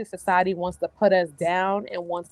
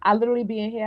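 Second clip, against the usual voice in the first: ordinary talk, nothing marked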